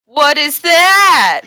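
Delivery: high, drawn-out shout; loud outburst. Real, not acted. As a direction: blurt it out loud, stretching the words. Player loudly breaks in on GM.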